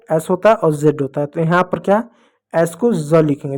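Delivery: ordinary delivery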